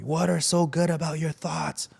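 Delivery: high-pitched retold scolding; imitated yelling. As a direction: act out the pastor yelling at you. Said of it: "I remember him yelling at me".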